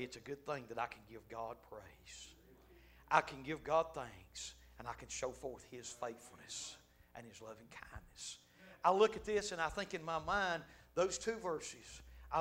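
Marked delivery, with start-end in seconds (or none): 0.0-8.4 softer, lower, humble thanksgiving; soft grateful praise. Acted. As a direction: murmur thanks like a private prayer. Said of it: "And we ought to say".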